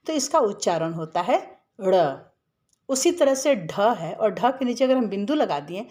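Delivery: ordinary delivery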